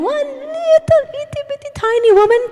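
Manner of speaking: put-on very high, teeny-tiny squeak of a voice; mock-dainty high pitch; tiny cutesy voice. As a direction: shrink it down to itty-bitty size, way up high. Belittles the threatening woman.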